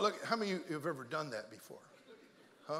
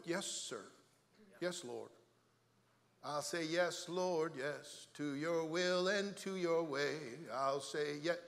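humble surrender; 3 semitones lower, 4 dB quieter, much slower than usual. Shown as a demonstration. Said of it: "What's wrong with".

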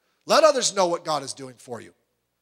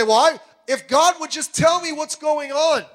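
whiny complainer; 9 semitones higher, 5 dB louder than usual. Retold or imitated.

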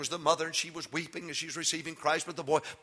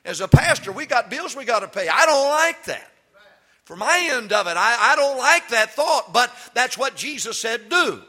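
loud, high preaching at full force; 7 semitones higher, 11 dB louder than usual. Acted. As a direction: preach it out to the congregation. Preaching peak.

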